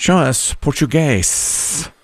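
slow, drawn-out announcer call; stretched-out game-show host reveal. Pretend. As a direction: draw out the answer for suspense.

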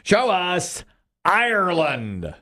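slow, ringing call to the answer board; game-show reveal call. Real, not acted. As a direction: stretch it out for suspense. Host calls for the board reveal.

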